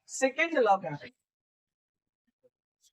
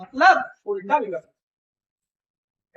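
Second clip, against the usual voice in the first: louder and slower, leaning on मतलब उल्टा; slow emphatic repeat; 7 dB louder, slower than usual. Real, not acted. Teacher driving home to students that it can be reversed, slow and loud. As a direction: hammer the point home, slowly.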